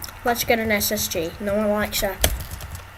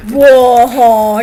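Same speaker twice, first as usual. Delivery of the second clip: loud surprised whoa; 10 dB louder than usual. Real, not acted. Sudden surprise in game.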